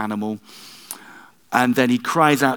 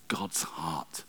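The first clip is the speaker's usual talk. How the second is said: much softer, breathy whisper; whispered awe; 8 semitones lower, 14 dB quieter than usual. Real, not acted. Preacher to congregation on God's heart, whispered tenderly. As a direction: hush it with wonder.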